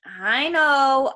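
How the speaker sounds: grumbled. A narrator's reading. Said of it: "I grumbled".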